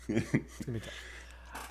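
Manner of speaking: slow whispered mock sorrow. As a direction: breathe it out slow and sad. Repeated as sad whisper.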